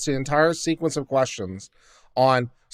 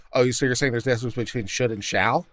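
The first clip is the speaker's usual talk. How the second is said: lower, softer, fast mocking impression of a condescending judge; mocking the justice; 6 semitones lower, 5 dB quieter, faster than usual. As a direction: mimic a smug judge, quick and low. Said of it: "And Justice Breyer's over here with, like".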